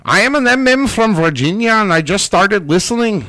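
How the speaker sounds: put-on faking an Irish accent, barely; barely-there Irish accent; bad Irish accent. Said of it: "That is not an Irish accent".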